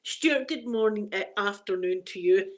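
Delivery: ordinary talk, nothing marked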